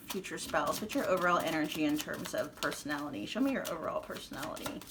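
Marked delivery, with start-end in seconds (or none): 3.3-4.8 whispering. Real, not acted. Asks the cards to reveal.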